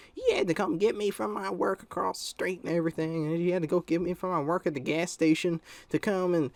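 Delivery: high, put-on complaining mother; imitated mad mom. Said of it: "she was all just mad".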